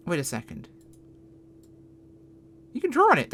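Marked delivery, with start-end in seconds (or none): 2.7-3.3 sudden high, loud discovery; delighted surprise. Real, not acted. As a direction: gasp of pleased surprise. Discovers drawing on tablet.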